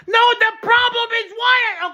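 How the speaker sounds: high, loud yelled outburst; frustrated yell. Acted. Patient yelling at the doctor, high frustrated yell. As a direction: blow up at the doctor.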